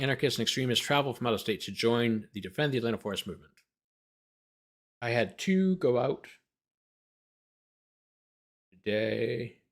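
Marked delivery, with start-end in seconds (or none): none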